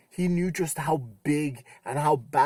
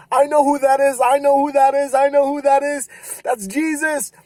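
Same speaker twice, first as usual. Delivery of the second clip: much higher and louder, bursting with excitement; excited recognition; 11 semitones higher, 10 dB louder than usual. Retold or imitated. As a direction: blurt it out, thrilled. John excited recognising Jesus.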